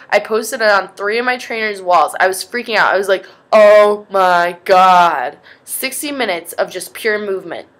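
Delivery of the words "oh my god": loud, burst-out dismay; loud retold panic. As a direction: blurt it out in alarm. Freaking out over the workout.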